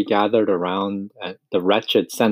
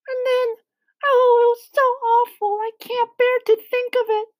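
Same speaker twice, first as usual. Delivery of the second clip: very high, squeaky lament; high-pitched spider, overcome by the memory; tearful Miss Spider. A narrator's reading. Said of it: "wiped away a tear and looked sadly".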